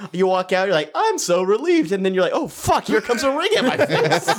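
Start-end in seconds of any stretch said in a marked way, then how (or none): 0.9-1.9 high, breezy mock relief; relieved. Bar patron stepping out, mock relieved. 2.3-4.3 high, laughing mock panic; mock alarm. Patron seeing the ring swing, mock alarm.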